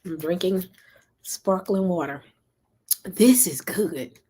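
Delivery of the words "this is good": whispered. Real, not acted. Savoring the drink.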